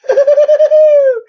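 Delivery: very high, loud bouncy cartoon laugh; hammed-up cartoon tiger cackle; Tigger laugh. Shown as a demonstration. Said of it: "another favorite of mine from Winnie the Pooh is this guy".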